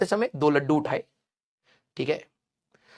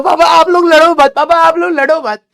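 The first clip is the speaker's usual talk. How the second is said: high, loud whining plea to stop the fight; imitating a child crying, much higher and louder; crying kid's plea; 9 semitones higher, 8 dB louder than usual. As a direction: beg Papa like a bawling child. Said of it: "और मैं रो रहा हूं".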